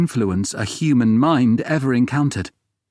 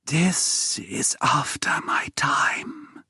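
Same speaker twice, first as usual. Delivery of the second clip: slow, higher whispered rasp with a strange rising tune; dusty rasping whisper; 7 semitones higher, 4 dB quieter, much slower than usual. A narrator's reading. Long-isolated woman tells the narrator the locator is new, slow dusty whisper. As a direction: breathe it out like dust, slowly. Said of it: "Her voice is like dust, just a rasp and a weird intonation".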